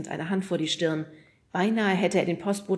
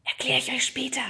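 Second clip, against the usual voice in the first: quick hissed aside, no voice; hissed whisper; 8 semitones higher than usual. Acted. Oda hisses to her friends, whispering. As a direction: hiss it under your breath. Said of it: "zischte sie".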